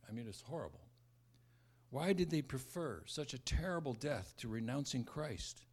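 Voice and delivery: ordinary delivery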